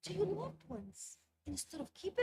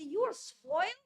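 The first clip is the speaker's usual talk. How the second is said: scolding parent; 5 semitones higher, 10 dB louder, slower than usual. Retold or imitated. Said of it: "A lot of people say".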